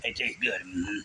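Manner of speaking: deep slow Sling Blade take. Retold or imitated. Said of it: "sounding like Sling Blade".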